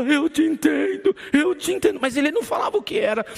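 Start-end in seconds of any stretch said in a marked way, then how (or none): 0.0-2.0 high, louder weeping words; crying repetition. Retold or imitated. Brother weeping on the pastor's shoulder, high and louder. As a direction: sob it out twice. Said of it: "e falava".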